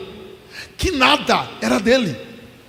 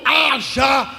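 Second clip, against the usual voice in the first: God's shout; much slower than usual. Acted.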